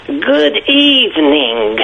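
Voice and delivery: very slow, drawn-out, spooky greeting; a deadpan ghostly greeting; slow eerie good evening. Retold or imitated. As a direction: stretch it out like a ghostly old woman. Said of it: "Just like that".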